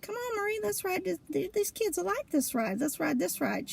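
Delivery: high, hushed coaxing tone; sweet coaxing. Retold or imitated. Luring Marie onto Space Mountain, high sweet coaxing. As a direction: sweet-talk her onto the ride.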